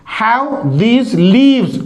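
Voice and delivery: slow, with long ee in "leaves"; long vowel. Shown as a demonstration. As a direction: draw out the ee of "leaves". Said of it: "ये long sound". ("leaves" में लंबी ई, धीरे)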